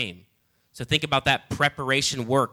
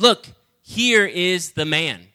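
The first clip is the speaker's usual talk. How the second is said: proclaiming; 5 semitones higher, 6 dB louder, slower than usual. Acted. Pilate presents Jesus.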